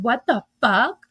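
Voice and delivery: drawn-out disbelief. Retold or imitated. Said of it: "The way you said it".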